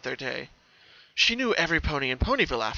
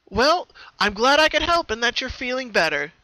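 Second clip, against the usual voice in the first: higher, pleased pony voice; happy Twilight; 11 semitones higher, 6 dB louder than usual. Acted. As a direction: glad to have helped. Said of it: "Twilight smiled happily".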